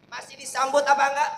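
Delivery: repeated loud and fast. Shown as a demonstration. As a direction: push the question again harder.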